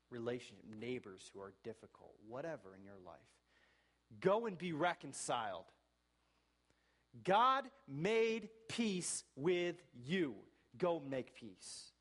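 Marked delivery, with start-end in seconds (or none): 4.2-10.3 loud, slow, ringing call from the pulpit; slow and booming, each word leaned on; preaching at full force. Real, not acted. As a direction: proclaim it like a plea to the whole church. Preacher's climactic call to reconcile.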